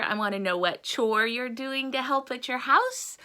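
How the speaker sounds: ordinary delivery, nothing marked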